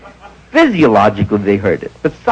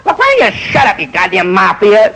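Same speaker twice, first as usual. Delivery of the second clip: heckling; 4 semitones higher than usual. Retold or imitated. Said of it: "Shelley comes up with a line".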